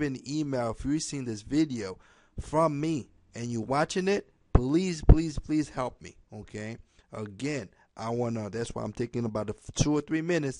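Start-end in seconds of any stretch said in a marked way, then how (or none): 4.5-6.2 louder, drawn-out begging please; pleading please. Real, not acted. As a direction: beg them earnestly, pressing each please. Pleading for viewers' help.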